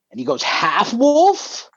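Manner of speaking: ordinary talk, nothing marked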